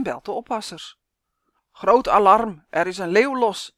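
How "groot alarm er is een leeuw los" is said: loud urgent alarm call; shouting into phone. Acted. Pet phoning the keepers, shouting. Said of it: "schreeuwt hij in zijn telefoon".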